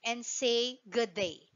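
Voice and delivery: slow, drawn-out Australian "good day" said as "G'day"; Australian slang. Shown as a demonstration. Teacher modelling the tourism tagline for class, slow Australian accent. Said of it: "That's how you pronounce that".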